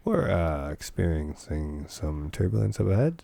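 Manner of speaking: low, droning cockpit announcement; captain on the intercom with long drawn-out uhs; mock pilot voice, low and soft; airline pilot. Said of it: "He was a pilot".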